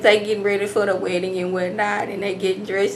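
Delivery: usual speaking voice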